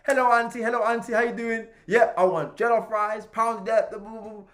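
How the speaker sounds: high, loud, breezy ordering; exuberant greeting. Retold or imitated. Customer greeting auntie and ordering jollof rice, exuberant.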